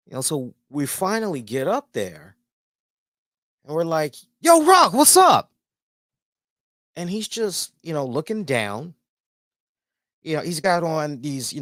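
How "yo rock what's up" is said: high, loud excited hail; excited fan calling out; shouted greeting. Retold or imitated. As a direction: holler hello to a star. Acting out greeting a celebrity.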